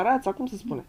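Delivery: ordinary talk, nothing marked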